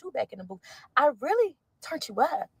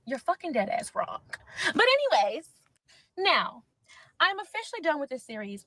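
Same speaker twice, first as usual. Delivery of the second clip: usual speaking voice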